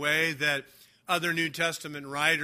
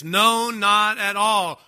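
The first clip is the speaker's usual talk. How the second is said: "no, not at all" slow, higher and louder; emphatic double negative; 4 semitones higher, 6 dB louder, slower than usual. Shown as a demonstration. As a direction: stamp each word of "no, not at all". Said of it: "here in the Greek, it's".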